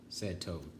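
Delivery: usual speaking voice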